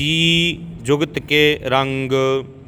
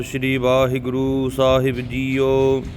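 careful teaching recitation in Punjabi; scripture recitation. Shown as a demonstration. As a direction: recite evenly, drawing out the long vowels.

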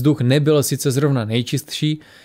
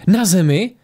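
high, startled question; blurted astonishment; 5 semitones higher, 4 dB louder, slower than usual. A narrator's reading. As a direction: burst out in disbelief, rising at the end. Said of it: "vyhrkla Tora".

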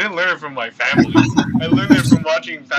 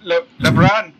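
put-on Pacquiao accent; 2 semitones higher, 4 dB louder than usual. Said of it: "This is how he talks".

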